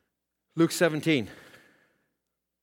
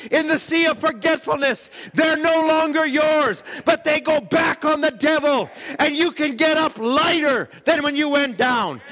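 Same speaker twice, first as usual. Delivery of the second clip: higher, ringing preacher's exhortation; preaching at full force; 12 semitones higher, 6 dB louder than usual. Acted. Preacher urging the congregation to pray, full-force preaching. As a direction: preach it out to the church, rising to a shout.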